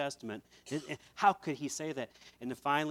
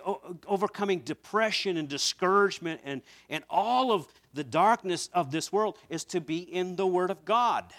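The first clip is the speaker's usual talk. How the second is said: full-force preaching; 5 semitones higher, 6 dB louder than usual. Real, not acted. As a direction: preach it out to the whole church. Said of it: "Let me just preach to you".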